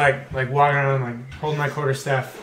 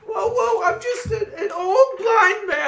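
put-on high, frail old beggar voice; old blind man voice. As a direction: play a lost, helpless old blind man. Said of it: "the whole blind guy thing".

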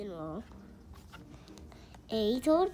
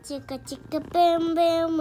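louder, higher chanting of Chicka Chicka Boom Boom; loud refrain; 4 semitones higher, 8 dB louder than usual. Acted.

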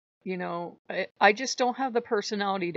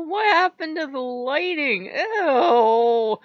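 high, loud dismayed wail; mock disgust; 8 semitones higher, 9 dB louder, much slower than usual. Player seeing ruined game graphics, hammed-up disgust. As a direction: wail it, stretching the ending.

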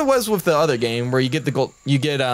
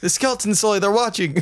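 high, playful warning; hammed-up nervous warning; mock alarm; 6 semitones higher than usual. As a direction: pretend to panic about being watched. Mock warning about skeletons.

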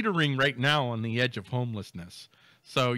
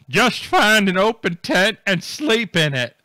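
loud, high, drawn-out mock announcement; mock coordinator's announcement; 6 semitones higher, 10 dB louder, slower than usual. Coordinator telling stranded festivalgoers where to sleep, mock shouted announcement. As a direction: call it out slowly like a coordinator giving up.

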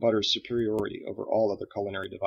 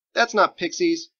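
skeptical protest; 9 semitones higher, 7 dB louder than usual. Acted. Boy objecting.

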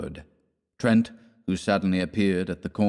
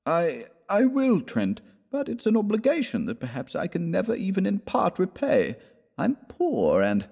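high, hesitant, embarrassed acceptance; reluctant protest; 11 semitones higher than usual. Acted. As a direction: protest haltingly, voice raised in pitch. Proud poor friend reluctantly accepts money.

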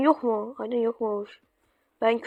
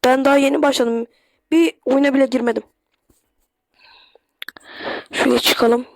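ordinary talk

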